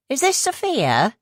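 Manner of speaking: high, slow West Country woman on the intercom; a sly West Country stranger asking; West Country accent. Acted. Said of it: "the accent heavy West Country".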